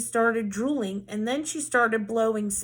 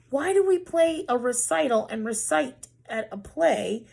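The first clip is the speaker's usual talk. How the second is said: whispering; 2 semitones higher than usual. Acted. Teacher posing a riddle.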